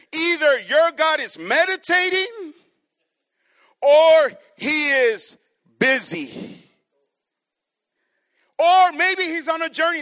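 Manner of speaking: high, loud sneering preacher jeer; mock them as if their god is away; mocking taunt. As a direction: Elijah taunting the prophets of Baal, loud and mocking. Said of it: "He's mocking them".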